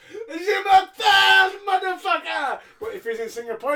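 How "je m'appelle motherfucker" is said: mock Cena shout. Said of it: "he goes".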